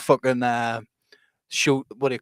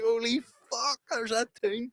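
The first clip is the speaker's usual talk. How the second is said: high, soft, amazed recognition; light up higher at finding the lost tune; a fan finding the lost tune at last, high and amazed; delighted surprise; 10 semitones higher, 6 dB quieter, slower than usual. Retold or imitated. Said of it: "I was like".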